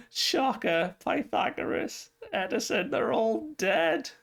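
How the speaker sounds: high, wailing sob-voice with a broken whisper on a name; mock bawling. Lilith bawling over her dead fellow satellites, hammed-up high wail. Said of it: "bawling, like, crying".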